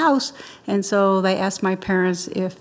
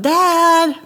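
raised, high call across the house; yell for dad; 9 semitones higher, 5 dB louder than usual. Retold or imitated. Child summons dad to the phone, yelling. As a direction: holler out for a parent, re-enacted. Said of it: "I yelled".

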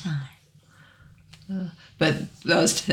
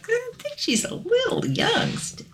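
high, softer prim voice; high mock voice; 4 dB quieter than usual. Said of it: "And I'm like".

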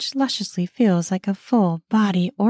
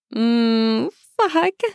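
aroused moan; 3 semitones higher than usual. A narrator's reading. Aroused moan during story.

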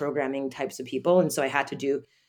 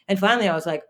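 ordinary talk, nothing marked

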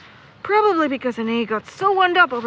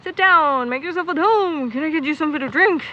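welcoming host; 5 semitones higher, 3 dB louder than usual. Retold or imitated. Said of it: "I was taught to make sure guests were comfortable".

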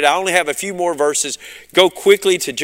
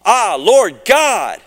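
slow, high, loud cry of awe; with an exclamation; 5 semitones higher, 5 dB louder, much slower than usual. Shown as a demonstration. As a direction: exclaim it fully, slowly and loudly, in wonder. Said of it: "I need to say that a little bit more".

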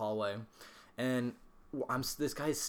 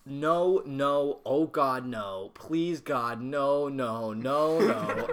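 panicked pleading; 7 dB louder, much slower than usual. Retold or imitated. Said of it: "and went".

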